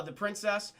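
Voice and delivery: ordinary talk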